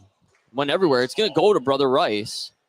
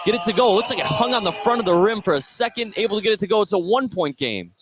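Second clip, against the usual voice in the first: high-pitched, still buzzing from the basket; excited play-by-play; 6 semitones higher, faster than usual. Real, not acted. Announcer to listeners describing the made basket, excited. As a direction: keep the thrill up after the score.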